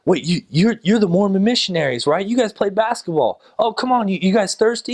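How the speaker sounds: high-pitched, eager imitation of locals; excited recognition. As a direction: act out a fan spotting someone familiar.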